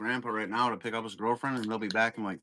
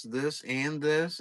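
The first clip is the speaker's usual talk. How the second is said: drawn-out list; 4 semitones higher, much slower than usual. Retold or imitated. Kid listing food items.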